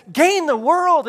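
loud, high-pitched exhortation; emphatic urging. Acted. Foolishness speaks to the people, loud emphatic urging. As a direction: belt it out high, like a tempting command. Foolishness voiced against Jesus's answer.

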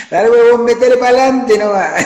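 hammed-up Chilean mother urging him on; Chilean mom voice. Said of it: "con la tonada chilena". (imitación exagerada de madre chilena, más aguda y fuerte)

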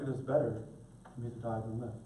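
low, quiet, unbothered reading; mildly understated delivery; calm, resigned. Shown as a demonstration. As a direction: say it softly and low, with no anger. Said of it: "I don't hear Jonah being like".

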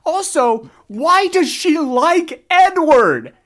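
loud, high, slow outburst; exasperated rant. Real, not acted. Fan ranting that Edward sucks, loudly exasperated. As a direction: rail at full volume, stretching the words.

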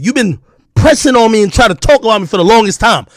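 ordinary talk, nothing marked

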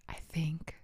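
soft secretive whisper. Real, not acted. Host whispering to listeners about smoking a joint. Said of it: "I don't know why I'm whispering".

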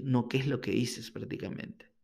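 usual speaking voice

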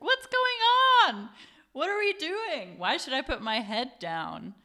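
put-on high, loud, confused drunken questions. Drunk friend blurting questions in the car, high and loud. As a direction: shout it out like a clueless drunk friend.

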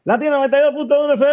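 high, fast station ID call; radio announcer voice. Retold or imitated. Radio host reenacting his on-air intro, announcer voice. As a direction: hype it up like a DJ going on air.

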